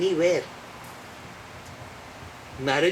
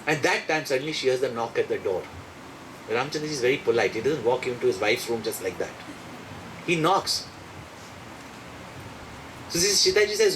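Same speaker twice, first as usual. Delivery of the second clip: ordinary talk, nothing marked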